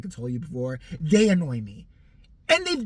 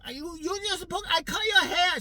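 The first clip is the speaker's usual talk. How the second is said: put-on lightly higher voice for the old stylist; a hint of the former stylist; mock stylist voice; 11 semitones higher than usual. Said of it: "Whatever happened to that one girl".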